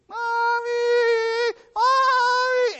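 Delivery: put-on high, loud wail of a lost little kid; a child's high frantic call for mommy; lost child crying out. Lost child calling for mother.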